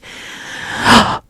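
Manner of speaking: sharp high airy gasp; a quick startled intake of breath; whispered gasp. Real, not acted. Sudden gasp mid ASMR chat.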